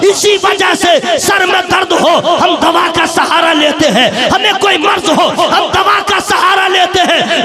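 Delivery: loud, high preaching in rising waves; fiery sermon. Acted. Preacher thundering to the gathering about saints, loud impassioned preaching. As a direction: preach it out to the crowd with full force.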